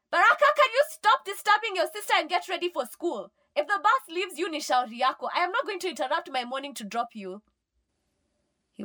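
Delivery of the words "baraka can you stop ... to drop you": high, loud, fast scolding; exasperated mother shouting. A narrator's reading. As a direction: yell a rapid telling-off at your son. Mom shouts scolding from elsewhere.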